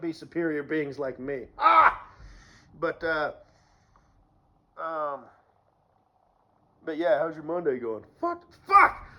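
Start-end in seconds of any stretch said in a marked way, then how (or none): 1.6-2.1 short, high, loud "ah"; sudden yell. Acted. After boasting of being a superior being, sudden yell. 8.2-9.1 high, loud cursing outburst; exasperated yell. Acted. Reacting to "how's your Monday going", exasperated yell.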